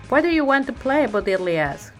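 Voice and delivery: ordinary delivery, nothing marked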